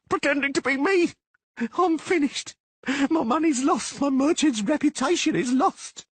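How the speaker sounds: high, loud, desperate tirade; despairing rant. Acted. Merchant despairing over his ruined money and name.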